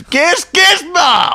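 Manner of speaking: very high, loud squeal of the name; yelling creature voice. Acted. Host playing Gizmo calling his own name, yelling. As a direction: stutter into a high creature shout. Acting Andy Dick's Gizmo yell.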